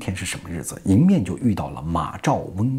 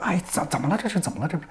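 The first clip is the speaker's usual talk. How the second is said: high, small stammering questions; pitched-up, softer puzzled chatter; flustered bystanders; 7 semitones higher, 5 dB quieter, much faster than usual. Acted. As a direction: ask it high and confused, lightly. Crowd asks what has happened.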